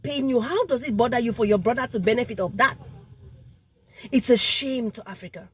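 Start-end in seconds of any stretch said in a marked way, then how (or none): none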